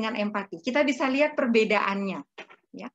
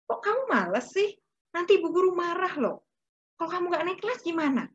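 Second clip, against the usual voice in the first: higher, rapid scolding nag; nagging; 6 semitones higher than usual. Acted. Parent nagging a child who won't do homework, scolding. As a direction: push the lazy child with worry and reproach.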